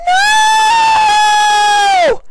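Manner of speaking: very high, loud scream of pain and anger; Fluttershy crying out in pain and anger; anguished shout. A narrator's reading. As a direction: let out a long exasperated cry. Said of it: "a long and exasperated shout of pain".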